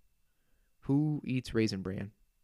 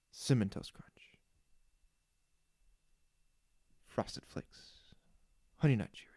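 whispering; 9 dB quieter than usual. Pretend. Naming cereals down the aisle.

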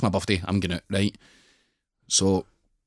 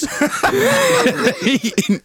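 high burst of laughter. Real, not acted. Laughing mid-story.

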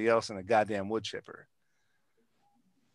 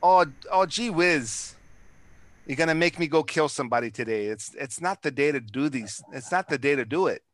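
put-on light folksy Minnesota accent; folksy Minnesota accent, playfully mock-fretting. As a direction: ease into a mild Minnesota "gee whiz". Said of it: "that Minnesota accent".

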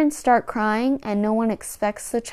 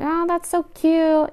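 put-on cooing at baby; 9 semitones higher, 4 dB louder than usual.

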